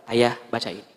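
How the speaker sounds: low, soft tearful words; soft request. Retold or imitated. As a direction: say it quietly, holding back tears. Wife hands phone softly. (istri berkata lirih sambil menahan tangis)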